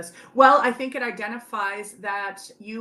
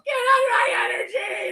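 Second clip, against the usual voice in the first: mock shouted retort; 12 semitones higher than usual. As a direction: throw it back at her as a playful yell. Husband's retort retold at dinner.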